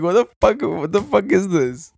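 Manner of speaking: high-pitched, incredulous mock reaction; mock disbelief. Telling a friend about petless cribs, mock disbelief. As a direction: act baffled, voice pushed up high.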